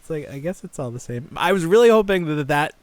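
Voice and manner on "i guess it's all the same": ordinary talk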